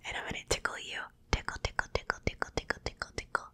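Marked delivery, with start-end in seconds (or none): none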